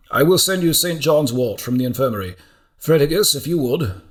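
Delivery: brisk, commanding mentor taking charge; a decisive healer-monk directing his scribe; decisive old monk. Acted. Alcuin gives firm orders.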